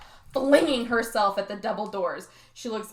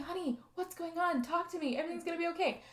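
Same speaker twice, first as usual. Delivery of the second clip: higher, softer comforting dad; soothing dad; 6 semitones higher, 6 dB quieter, faster than usual. Retold or imitated. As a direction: coax the daughter gently. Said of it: "before he was like".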